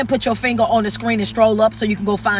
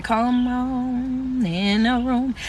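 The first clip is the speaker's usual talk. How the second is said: churchy welcome; 2 semitones higher, 3 dB quieter, much slower than usual. Acted.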